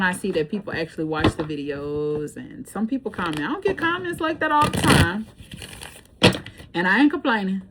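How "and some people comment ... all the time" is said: ordinary talk, nothing marked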